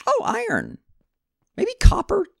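delighted high exclamation; excited. Real, not acted. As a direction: squeal at the find. Excited find of ore.

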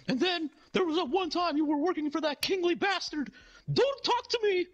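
very high, strained manic demand; hammed-up furious clown in a shrieky falsetto; Ronald McDonald. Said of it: "a little manic".

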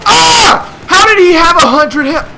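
very high, loud yell of disbelief; outraged scream. Real, not acted. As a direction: scream in outrage after getting killed. Killed by full-health enemy.

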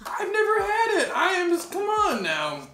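high, loud excited outburst. Real, not acted. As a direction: gush with giddy excitement. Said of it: "It's my first mukbang".

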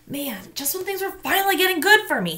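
put-on a kid grumbling that life isn't fair; sulky boy venting in his head; Marshall's frustrated thought. A narrator's reading. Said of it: "he thought".

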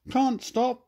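high, loud hurried piggy voice; oinking pig. Acted. Pigs rushing past Fergus to the barn, high squealing voice. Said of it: "they oinked".

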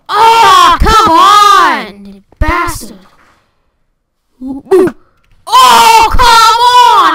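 loud, high anguished cry; frustrated yell. Real, not acted. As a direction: howl in frustration. Frustration after dying.

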